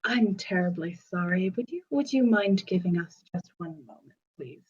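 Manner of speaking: soft, fast, sugary politeness; overly polite. Acted. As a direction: smile hard and rush the apology. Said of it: "most polite smile and go".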